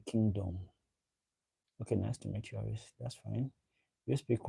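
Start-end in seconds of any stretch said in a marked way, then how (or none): none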